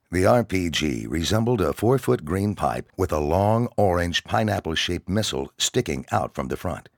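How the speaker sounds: usual speaking voice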